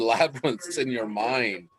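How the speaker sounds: ordinary talk, nothing marked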